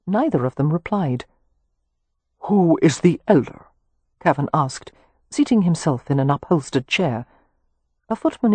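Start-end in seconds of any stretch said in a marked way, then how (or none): 2.4-3.7 imperious Anglo-Irish earl asking; a lord's cool Anglo-Irish question; Anglo-Irish accent. A narrator's reading. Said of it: "in flowing Anglo-Irish accent".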